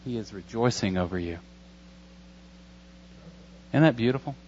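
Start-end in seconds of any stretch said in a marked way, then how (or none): 0.0-1.4 hushed and much softer, voiced but low; soft Spirit voice. Pastor voicing the Holy Spirit to the church, hushed and much softer. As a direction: give the Spirit a hushed, intimate tone. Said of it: "His Holy Spirit whispers in our ears".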